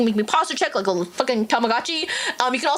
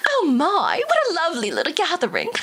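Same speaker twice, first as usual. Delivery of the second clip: amused, higher, laughing purr; haughty vampire lady; 6 semitones higher than usual. Acted. Vampire lady eyeing the crowd, higher amused purr. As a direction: savour it, mock charming.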